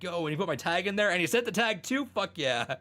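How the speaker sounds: high, pumped-up bragging; hyped up. Retold or imitated. [name] bragging to friends about his tag, hyped. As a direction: retell it like he's buzzing with excitement. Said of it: "he's all hyped up".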